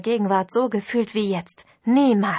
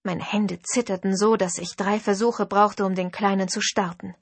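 ordinary delivery, nothing marked

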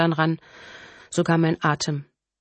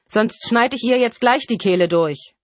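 loud, raised-pitch threat; shouted threat; 5 semitones higher, 5 dB louder than usual. A narrator's reading. Killer threatens to cut the daughter's throat, shouted threat. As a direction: threaten loudly to stop someone in their tracks. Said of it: "rief Colin Friendly".

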